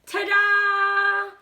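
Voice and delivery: high loud fanfare shout; blasted. Acted. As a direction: blast it like a brass band. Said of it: "blasted La Banda".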